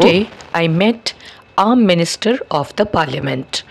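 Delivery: slow, deliberate English sentence; slow model sentence. Shown as a demonstration. Teacher modelling the long form for students. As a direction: read it out slowly word by word. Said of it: "তাহলে বলবো".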